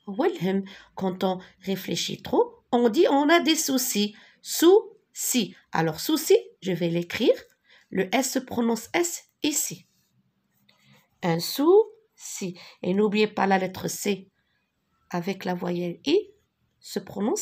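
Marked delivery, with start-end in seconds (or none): 4.4-5.5 "Souci" in two separate beats, sou and si, starting high; syllable by syllable. Shown as a demonstration. Teacher sounding out a word for pupils, syllable by syllable. 11.2-12.6 slow "Un souci", with a gap between sou and si; syllable by syllable. Shown as a demonstration. Teacher dictating as she writes, syllable by syllable.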